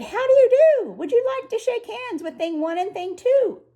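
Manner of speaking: put-on squeaky twin creatures greeting the children; Thing One and Thing Two. A narrator's reading. Thing One and Thing Two greet the children, high and chirpy. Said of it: "They said".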